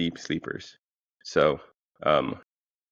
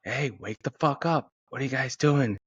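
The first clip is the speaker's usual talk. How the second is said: mock yell; 7 semitones higher than usual. Uncle knocking harder to wake sleeping roommates, mock yell. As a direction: call through the door, urgent but held back. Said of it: "Knock harder".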